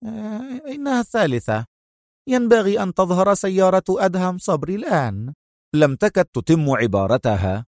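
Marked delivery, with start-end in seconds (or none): 0.1-5.3 higher, edgy anticipation; a touch of nervous tension; tense waiting. Acted. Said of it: "قائلة في توتر". (بتوتر)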